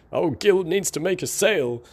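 a hint of a pleading, hapless salesman; Gil impression. Quoting a sad cartoon salesman's catchphrase, lightly imitated. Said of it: "One of his catchphrases is".

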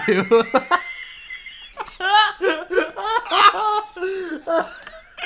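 high squealing burst of laughter; shrieking laugh. Real, not acted. Explosive laugh at pickup line.

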